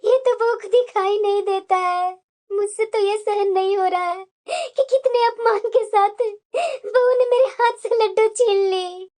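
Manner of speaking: crying lament with sobbing breaths; tearful hurt. Acted. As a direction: pour out your humiliation through tears. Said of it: "तुम्हारी आंखों में आंसू क्यों है". (रोते हुए, आहत स्वर में)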